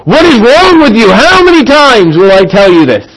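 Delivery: put-on high, loud, fed-up outburst at the kids; a parent's mock yell of frustration; exasperated parent yell. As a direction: blow up at a child who won't listen. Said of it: "maybe not in such a vehement anger".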